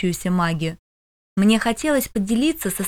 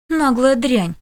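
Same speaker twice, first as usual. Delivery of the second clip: sharp, louder contemptuous hiss; 4 semitones higher, 4 dB louder, slower than usual. Acted. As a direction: spit it out with scorn. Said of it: "такого определения от обычно спокойной".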